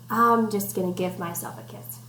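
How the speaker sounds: ordinary delivery, nothing marked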